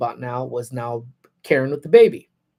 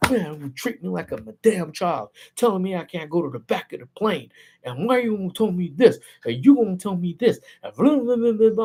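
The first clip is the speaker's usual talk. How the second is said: whiny mock complaint, pitched higher; mock tantrum; 4 semitones higher than usual. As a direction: imitate a grown man throwing a fit, rising into blah-blah. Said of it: "throws the biggest fit".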